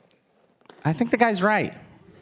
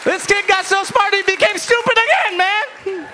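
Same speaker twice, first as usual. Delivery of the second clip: high, loud outraged immigrant dad; 6 dB louder than usual. Retold or imitated. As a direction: shout it like an indignant Cuban father. Dad voice continues.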